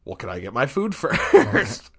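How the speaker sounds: slow, cheeky question breaking into a laugh; asking the furious cashier for food, laughing. Retold or imitated. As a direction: laugh through the line. Joking reply to angry cashier.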